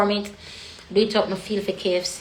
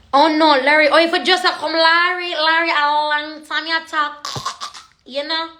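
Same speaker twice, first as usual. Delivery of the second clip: excited greeting; 6 semitones higher, 5 dB louder than usual. Real, not acted. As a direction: cry out at spotting someone. Greets viewer she spots on live.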